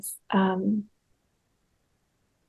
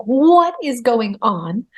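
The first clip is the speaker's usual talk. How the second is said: stretched-out, higher bewildered question; amazed inner watcher; 4 semitones higher, 4 dB louder, much slower than usual. Retold or imitated. Part of her watching the whole birth, astonished. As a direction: marvel slowly at what is happening. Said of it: "a part of my consciousness was watching".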